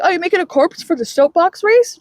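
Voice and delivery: high, oblivious friendly query; chirpy retold question. Friend chatting to Harper over the head, high and chirpy.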